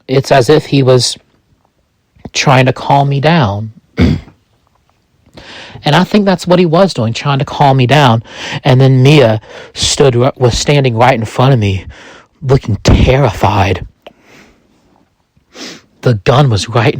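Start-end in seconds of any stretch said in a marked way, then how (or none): none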